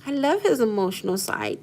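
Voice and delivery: normal talking voice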